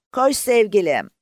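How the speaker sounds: high, loud cry to the galloping horse; joyful yell from a plane; shouted into the wind. A narrator's reading. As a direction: fling it out into the wind. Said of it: "Rüzgara doğru bağırdı".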